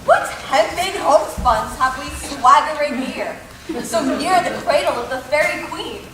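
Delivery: mischievous scoffing. Acted. Puck scoffs at amateur players.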